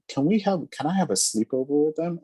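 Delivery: imitating young daughter. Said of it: "my daughter was saying".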